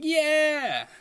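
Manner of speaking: high loud crowd cheer. Retold or imitated. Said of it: "they were cheering".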